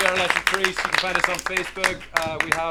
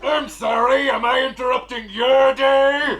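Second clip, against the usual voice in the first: sarcastic god voice; 6 semitones higher, 5 dB louder, slower than usual. Acted. God annoyed at being bothered.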